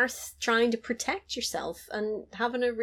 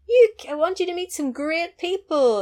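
excited; 10 semitones higher, 6 dB louder than usual. Retold or imitated. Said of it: "so she's like".

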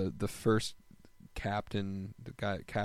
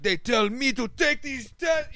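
high, loud, slowed-down rant; retold yelling; 13 semitones higher, 7 dB louder than usual. Israeli guy yelling about covid tests at the pool, loud and high. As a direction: bellow it across the pool like a fed-up man. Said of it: "yelling to the pool".

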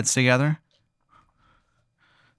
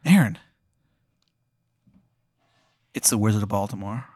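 put-on Maryland accent; faster than usual. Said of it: "terrible Maryland accent".

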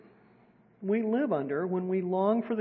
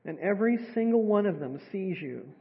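guided meditation; 2 semitones lower than usual. Acted. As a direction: gently guide them into stillness.

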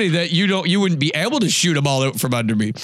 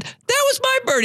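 mock yell. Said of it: "And I yelled".

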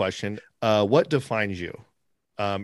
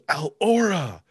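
imitated yell; 7 semitones higher, slower than usual. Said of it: "Wilson yells".

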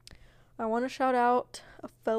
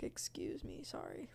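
whispered; 12 dB quieter, slower than usual. Retold or imitated.

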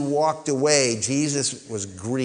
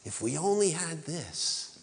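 soft, wistful wonder; hushed imitation of being impressed; retold awe; 10 dB quieter, slower than usual. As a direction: murmur it as a longing aside. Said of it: "And I'm like".

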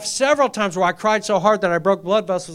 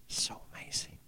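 a hushed, wondering whisper; awed whisper; 6 semitones lower, 18 dB quieter than usual. Real, not acted. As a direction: breathe it out as a whisper. Marvelling at God's work.

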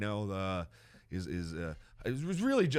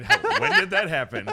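laughing; 8 semitones higher, 11 dB louder than usual. Retold or imitated. Laughing quote.

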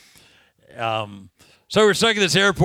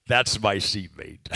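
low, slow and softer, with a laugh; amused confiding aside; 8 semitones lower, 5 dB quieter, slower than usual. Retold or imitated. Traveler to companions about the sweaty man, amused low aside. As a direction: confide it slowly, dropping the pitch.

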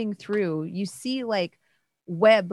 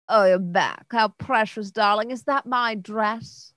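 lady of the house voice; 4 semitones higher than usual. Acted.

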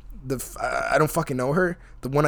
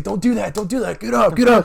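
mock urging; 5 semitones higher than usual. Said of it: "he's like".

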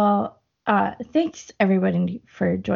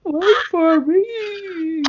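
slow, high howling dog voice; droopy basset hound; 9 semitones higher, 3 dB louder, much slower than usual. Pretend. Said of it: "if that's what your basset hound sounds like".

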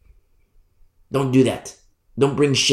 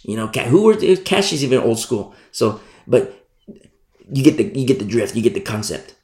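ordinary talk, nothing marked

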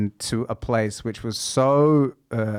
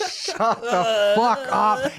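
laughing; 9 semitones higher, much slower than usual. Real, not acted. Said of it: "It's cringy".

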